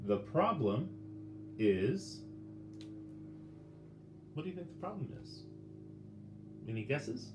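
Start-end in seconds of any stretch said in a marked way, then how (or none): none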